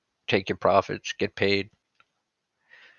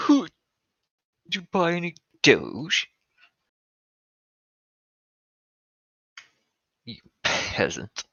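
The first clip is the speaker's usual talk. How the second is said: haughty upper-class snob; snooty voice; 9 semitones higher, slower than usual. Pretend. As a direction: look down your nose, slow. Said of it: "In my snot voice?".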